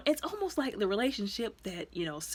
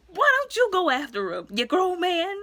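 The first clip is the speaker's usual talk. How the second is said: much higher and louder, outraged; indignant yell; 9 semitones higher, 6 dB louder than usual. Retold or imitated. Viewer yelling at the father on screen, outraged. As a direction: call him out loudly.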